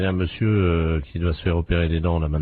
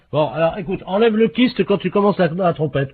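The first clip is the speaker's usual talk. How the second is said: calling across the room; 12 semitones higher than usual. Acted. Calling to wife in other room.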